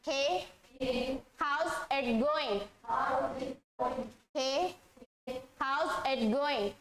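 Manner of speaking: very slow, drawn out; slow repeat-after-me read; slow model phrase. Shown as a demonstration. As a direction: model it slowly for the class to repeat. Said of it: "मेरे बाद".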